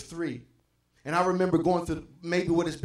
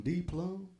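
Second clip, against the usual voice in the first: southern twang; 6 dB quieter than usual. Retold or imitated. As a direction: mimic a friend drawing out a southern twang. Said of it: "southern twang".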